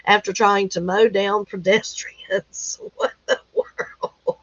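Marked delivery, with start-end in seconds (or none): 2.9-4.4 high breathless laugh through the words; cracking up in disbelief. Real, not acted. Laughing at a headline to viewers, breathless.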